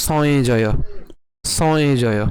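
সয়ে জয় with a nasal glide before the j; nasal pronunciation. Shown as a demonstration. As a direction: send the middle of সয়ে জয় through the nose. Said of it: "নাকে উচ্চারণ হবে".

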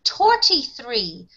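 Irish thirty-three said as "torty-three"; Irish pronunciation. Shown as a demonstration. Teacher explaining it sounds like Turkey, Irish pronunciation. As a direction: say thirty-three with hard t's for th. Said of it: "but they pronounce it".

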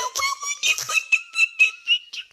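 squealing high laugh; real burst of laughter; high shrieking laugh. Real, not acted. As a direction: let out a shrill cackle. Laughing at bad play.

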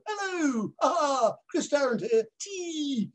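high, exaggerated impressionist parody of a TV host; mock showbiz impression. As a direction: ham up a silly caricature of a quiz host. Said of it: "all the impressionists like Rory Bremner and co go".

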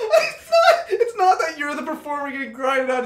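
high, squealing through laughter; can barely get the words out for laughing; laughing so hard it nearly turns to tears; helpless laughter. Real, not acted. Cracking up at the confession.

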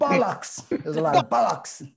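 high, loud comic outburst; mock British yell. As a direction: shout it like a hammed-up Brit. Joining in mock British yelling.

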